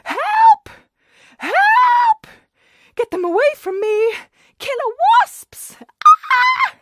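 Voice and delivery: shrill, very high panicked screaming. Acted. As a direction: scream for help as if the bees are on you. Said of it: "Missus Lavender began to scream".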